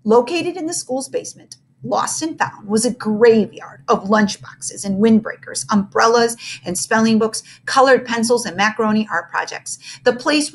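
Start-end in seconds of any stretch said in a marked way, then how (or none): none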